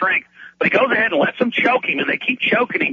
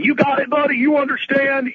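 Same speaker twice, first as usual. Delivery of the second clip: high, barking drill sergeant voice; imitating a drill sergeant getting in his face; mock drill sergeant, pitched up; 8 semitones higher than usual. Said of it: "like a drill sergeant".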